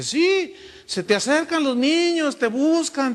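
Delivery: imitating an insistent mentor; insistent repeat. Mentor insists, repeating his question.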